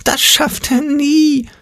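very high, slow panicked warning; shrill fearful alarm, drawn out; Angstpeter crying out. A narrator's reading. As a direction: squeal it like a terrified imp. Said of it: "schrie der Angstpeter".

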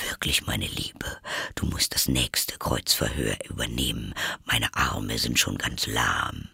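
low, soft whispered aside; whispering. A narrator's reading. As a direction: whisper it to your wife. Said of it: "fügte er leise hinzu".

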